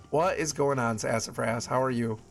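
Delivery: ordinary delivery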